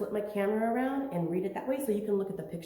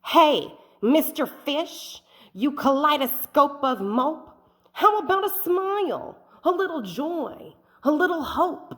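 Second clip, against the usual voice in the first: higher, pushy squid teasing the fish; slightly rude squid voice, higher; cheeky squid; 7 semitones higher, 8 dB louder than usual. Acted. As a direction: tease him toward a smile.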